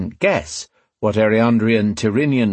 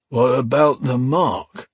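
groggy, higher-pitched slow question; slow, slurred; 4 semitones higher, slower than usual. A narrator's reading. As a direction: drag the words out in a dazed slur. The slurred, groggy man answers.

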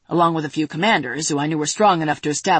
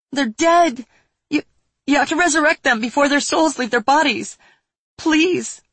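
high, loud desperate begging; tearful pleading; 9 semitones higher, 5 dB louder than usual. Acted. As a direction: sob out the plea, voice breaking. Grieving girl begs resurrection.

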